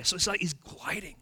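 normal talking voice

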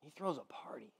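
whispered aside. Real, not acted. Preacher confiding to the congregation, whispered. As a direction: drop to a secret whisper.

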